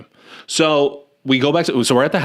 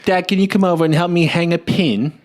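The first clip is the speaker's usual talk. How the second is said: mock Cockney daughter, pitched higher; bad Cockney accent; 6 semitones higher than usual. Mimicking the movie daughter asking dad for help, bad Cockney accent. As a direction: put on a hammy British Cockney accent. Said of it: "That was me doing her accent perfectly".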